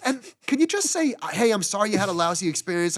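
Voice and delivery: high, pleading exasperation; exasperated pleading. Retold or imitated. Retelling a plea to the Delta agent, high and exasperated.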